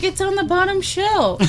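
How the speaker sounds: high-pitched mock wife reply; wife answering. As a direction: call back to a yelling husband. Voices wife answering yelling husband.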